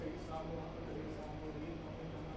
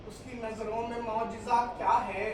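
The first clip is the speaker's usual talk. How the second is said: raised, ringing verse declamation; mushaira ghazal recitation; 6 semitones higher, 14 dB louder than usual. Acted. Poet reciting on love and God to an audience, declaimed ghazal. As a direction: project each half-line to the hall.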